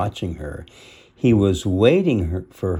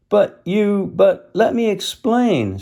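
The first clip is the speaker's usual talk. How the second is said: high, louder stammering plea; higher, louder stammering Tramp; stammered; 13 semitones higher, 5 dB louder than usual. Acted. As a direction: trip over the words, desperate. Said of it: "stammered Tramp".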